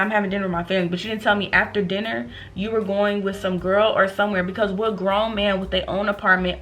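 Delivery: ordinary talk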